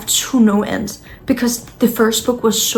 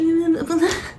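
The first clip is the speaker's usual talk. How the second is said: laugh; 7 semitones higher, 3 dB quieter than usual. Real, not acted.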